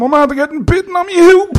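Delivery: very high, loud mock wail; hammed-up cry of a wounded man; wailing bite victim. Bitten victim explains to emergency operator, hammed-up high wail.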